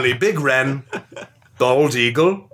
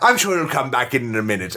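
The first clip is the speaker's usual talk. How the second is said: ordinary talk, nothing marked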